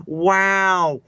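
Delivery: drawn-out wow, then marvelling; Owen Wilson. Retold or imitated. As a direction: gush in mock amazement like the actor. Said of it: "when he's talking to Hem".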